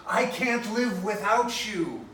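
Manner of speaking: hammed-up lovesick outburst; desperate declaration. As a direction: declare undying love in torment. Overblown declaration contrasted with mild one.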